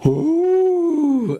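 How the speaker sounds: usual speaking voice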